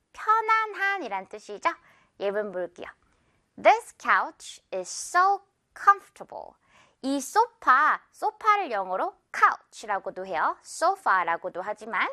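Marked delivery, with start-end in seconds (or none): 5.7-6.5 "comfortable" with COME high, falling after; first-syllable stress. Shown as a demonstration. Teacher models correct comfortable, first-syllable stress. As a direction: hit COME in "comfortable", squeeze the rest. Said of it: "come 부분에 확 강세".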